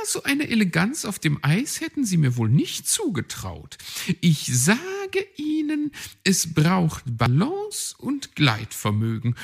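high, bright-pitched boastful bird voice; a vain magpie showing off her skating; Frau Elster the magpie. A narrator's reading. Magpie boasting to Herr Fuchs about her elegance, high and proud.

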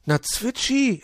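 very high, puzzled reply; baffled high character voice; uncomprehending. A narrator's reading. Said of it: "entgegnete Puh verständnislos".

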